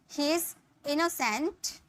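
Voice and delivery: slow, clear dictation; slow model sentence. Shown as a demonstration. Teacher dictating the translation slowly. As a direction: stretch it for students to follow. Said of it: "वही तो हम बोलेंगे".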